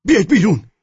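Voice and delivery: high, loud barked command; harsh order. A narrator's reading. SS officer ordering the boys out, harsh and loud. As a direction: snap the order like a furious officer. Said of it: "با تندی گفت".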